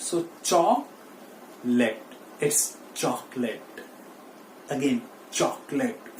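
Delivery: chocolate said as chok-let, two syllables, very slowly; correct pronunciation. Shown as a demonstration. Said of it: "फिर से बोलिए मेरे साथ". (सही उच्चारण, चॉकलेट, धीरे)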